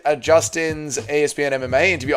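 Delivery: ordinary talk, nothing marked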